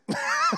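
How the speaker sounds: high short giggle; a quick, shrill giggle; nervous laugh. Real, not acted. Laughs after quoting God.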